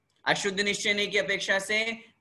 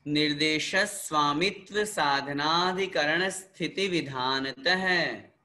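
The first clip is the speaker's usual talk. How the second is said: sutra recitation; slower than usual. Shown as a demonstration. Teacher recites sutras for repetition.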